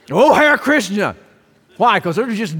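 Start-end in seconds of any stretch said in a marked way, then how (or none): 0.1-1.2 loud, high mock cry of "Oh, Hare Krishna"; playful mock exclamation. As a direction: call it out like a stubbed toe.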